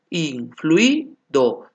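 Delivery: Influido said slowly, each vowel drawn out; slow syllable demo. Shown as a demonstration. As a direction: spell out Influido slowly by sound. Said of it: "Lo mismo pasa con influido".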